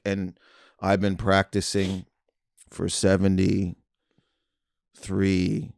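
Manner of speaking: half-asleep magician, very slow with long pauses; David Blaine. Retold or imitated. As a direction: imitate a drowsy daredevil, drawing each phrase out. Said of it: "half asleep".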